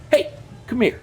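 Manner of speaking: forceful high retold call. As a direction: summon him like a boss. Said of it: "it was forceful".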